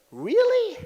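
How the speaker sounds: high, squeaky mock "Really?"; mock amazement. Imitating the ambassador's delighted surprise at the job offer, high. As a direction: jump way up in pitch, playing her astonishment. Quoting her amazed reply.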